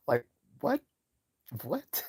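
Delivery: high, baffled what; can't believe what she just heard; incredulous what. Real, not acted. Reacting to a woman's slave claim, high disbelief.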